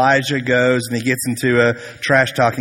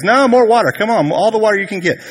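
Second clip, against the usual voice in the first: high, louder, hurried mock command; cocky goading; 9 semitones higher, 4 dB louder, faster than usual. Elijah ordering more water on the wood, cocky and high. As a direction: egg them on, voice pitched up.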